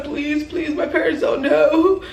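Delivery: high, whimpering mock plea; comic tearful begging, rising into a wail; boohoo crying. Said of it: "I'm boohoo crying".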